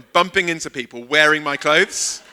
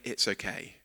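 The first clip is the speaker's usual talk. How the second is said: much softer and lower, brushed off; dismissive okay; 8 semitones lower, 14 dB quieter than usual. Shown as a demonstration. Preacher repeating the tolerant answer, softly dismissive. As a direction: let it drop away carelessly. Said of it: "forgiveness is not saying".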